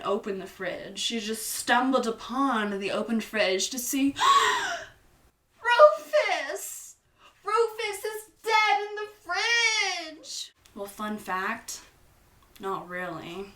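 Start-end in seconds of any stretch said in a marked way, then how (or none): 4.2-4.9 sharp, very high gasp of shock. Acted. Meg discovers her dead cat, sharp high gasp. 5.6-10.5 high, loud shriek of horror; horrified shriek. Acted. Meg finds Rufus dead in the fridge, high horrified shriek.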